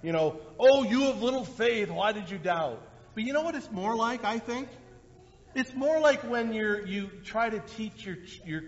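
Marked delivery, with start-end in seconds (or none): 0.6-2.8 mock harsh reading. Shown as a demonstration. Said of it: "coming kind of in a harsh way".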